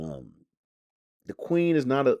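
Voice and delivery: usual speaking voice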